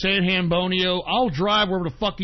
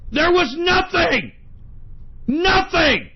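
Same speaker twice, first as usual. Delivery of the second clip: angry shout; 8 semitones higher, 4 dB louder, slower than usual. Real, not acted. Angry insistence about summit.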